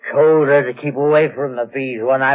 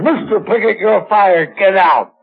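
higher, louder imitation of a tyrannical boss; imitated imperious bark, rising to a loud order; awful voice; 6 semitones higher, 3 dB louder than usual. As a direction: mimic the boss's dreadful dismissal. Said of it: "this awful voice, she said".

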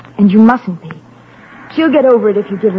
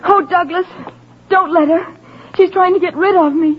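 frightened pleading; 9 semitones higher than usual. Acted. Girl pleads against being sent away.

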